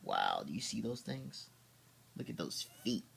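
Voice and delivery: usual speaking voice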